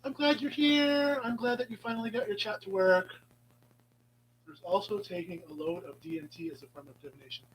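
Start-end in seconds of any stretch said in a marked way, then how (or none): 0.0-3.1 excited greeting. Real, not acted. Greeting arriving chat viewers.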